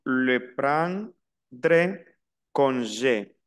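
le prendre congé drawn out very slowly; teacher repeating slowly; slow French demo. Shown as a demonstration. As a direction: model le prendre congé syllable by syllable. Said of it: "La E final aquí se pronuncia".

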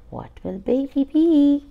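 usual speaking voice

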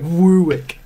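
"Woowick" as WOO-wick; "Woowick", first syllable rounded to WOO; imitated mispronunciation. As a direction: say "Woowick" with a drawn OO for Warwick. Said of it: "Foxtrot pronounces him".

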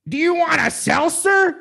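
high, loud, wide-eyed offer; imitating Tommy's loud, high seltzer offer. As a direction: shout the offer high and slow, bug-eyed. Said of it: "he goes".

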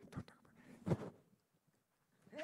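hushed whisper, very slow. Retold or imitated. Mom hushing the grieving aunt behind her, whispered. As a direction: hiss it under your breath.

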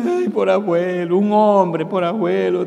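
high, loud hammed-up funeral crying; mock the crying family at the grave; mock sobbing mourners.

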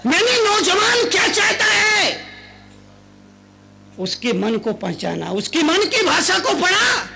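high, loud sermon shout; booming preacher; preaching at full force. Acted. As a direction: proclaim it to the crowd. Preacher proclaims about youth.

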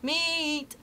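high, drawn-out seller's cry; street vendor's call. A narrator's reading. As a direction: sing out like a market hawker. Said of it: "someone calling".

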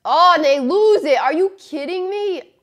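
loud, high outburst, slowing on the question; real exasperated shout; frustrated yell. Real, not acted. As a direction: yell at the screen in disbelief. Team loses the puck.

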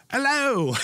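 high, loud, bad English accent; terrible English accent. Shown as a demonstration. Host shows how badly they do English, hammed-up English accent. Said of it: "here's what I do. This is all I can do. This is terrible".

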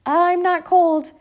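put-on high, loud, slow child's protest; a small girl insisting she's not cold, high and loud; little girl crying out. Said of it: "cried Emily".

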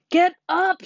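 very high, loud command; furious scream. A narrator's reading. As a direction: scream it in exasperation. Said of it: "screamed Wilbur".

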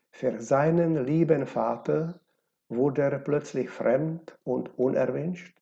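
ordinary delivery, nothing marked

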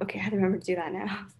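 rushed quick mental note, mock hurried; fast self-talk. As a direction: rattle it off quickly to yourself. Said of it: "I'm like".

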